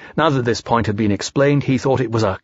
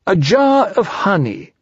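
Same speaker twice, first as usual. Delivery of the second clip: slow, higher-pitched bear voice; Pooh's insistence; 7 semitones higher, slower than usual. Acted. Pooh pressing for honey as bait, slow high voice. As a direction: draw it out dreamily as a little bear.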